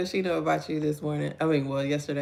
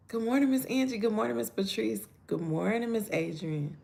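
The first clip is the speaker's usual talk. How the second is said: ordinary delivery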